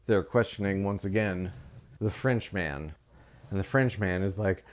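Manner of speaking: ordinary talk, nothing marked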